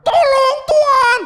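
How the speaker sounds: very high, loud, slow wail; hammed-up scream. Believer screams to God over small troubles, hammed-up. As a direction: overdramatic shriek for God's help. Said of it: "langsung teriak".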